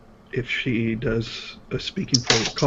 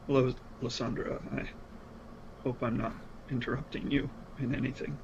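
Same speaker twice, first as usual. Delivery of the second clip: soft polite greeting; 12 dB quieter, faster than usual. Acted. Player voices his character politely.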